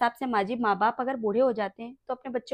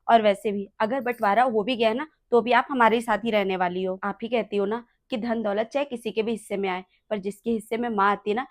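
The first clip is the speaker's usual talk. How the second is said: normal talking voice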